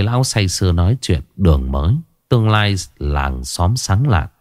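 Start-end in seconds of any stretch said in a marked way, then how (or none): none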